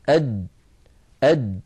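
أد with a sharp full stop, breath held shut; closed jim stop. Shown as a demonstration. Teacher shows students the closure of the letter. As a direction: clip أد hard so the sound stops dead. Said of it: "فلو قلنا أد نجد بأن المخرج ينقفل".

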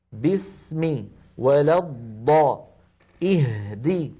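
equally held s, dad and h in "باسم، ولا الض-، اهدي"; equal letter timing. Shown as a demonstration. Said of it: "كل هذه الأزمنة متساوية".

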